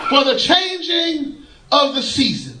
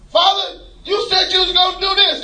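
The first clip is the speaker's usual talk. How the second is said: hollering; 8 semitones higher than usual. Retold or imitated. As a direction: holler it up at heaven. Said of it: "I holler".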